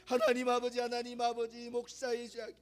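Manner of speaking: from a murmured confession down to near silence; fading prayer. Acted. Prayer subsides, quieting.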